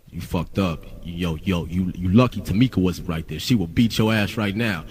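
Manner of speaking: low, hushed mock threat; Ruthless label guys talking. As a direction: imitate label heavies giving a quiet warning. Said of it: "that's how they talk".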